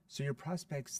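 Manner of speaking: ordinary talk, nothing marked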